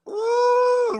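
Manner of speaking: long, high squealed ooh; admiring high "ooh". Real, not acted. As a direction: let out a high, thrilled whoop. Admiring squeal at guest's look.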